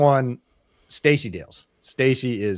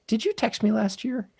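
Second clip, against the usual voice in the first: high-pitched imitation of an out-of-the-blue query; sudden puzzled question; 12 semitones higher than usual. Reporter asking about last year's text, suddenly curious. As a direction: mimic her sudden question in a raised voice.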